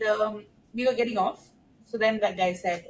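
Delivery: ordinary talk, nothing marked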